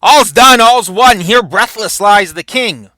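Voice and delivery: acted warrior's triumphant announcement; triumphant battlefield proclamation. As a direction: proclaim your kill across the battlefield. Douglas announcing the kill, read again.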